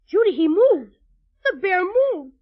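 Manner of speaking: loud, astonished exclamation; startled alarm. Acted. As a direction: blurt it out, startled and urgent. Startled that the toy moved.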